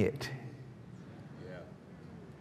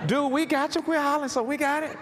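raised-pitch, quick imitation of a listener; mock listener complaint; 11 semitones higher than usual. Listener telling the hollering preacher they got it, mock protest. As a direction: play the overwhelmed listener, hurrying at the end. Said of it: "I'm hollering so loud. You're like".

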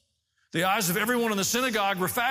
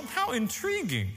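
slow, higher-pitched mock curiosity; detached onlooker; 4 semitones higher, slower than usual. Preacher mimics someone standing outside the story, mock intrigued. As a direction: draw it out like an uninvolved observer. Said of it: "stand outside of this and go".

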